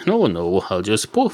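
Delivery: usual speaking voice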